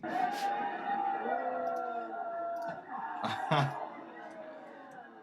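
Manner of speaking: high, long burst of real laughter; can't stop laughing at the shock. Real, not acted. Reacting to shocking wrestling clip.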